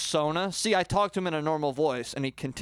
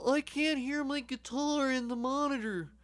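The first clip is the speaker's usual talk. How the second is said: high, softer complaining bandmate voice; 10 semitones higher, 5 dB quieter than usual. Shown as a demonstration. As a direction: whine like the guitarist. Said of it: "I'll play both roles".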